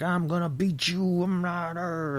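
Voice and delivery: high, slow put-on tough guy; imitating the angry biker in the ad; mock threat. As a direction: threaten in a hammed-up trucker voice. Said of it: "the guy's yelling and threatening".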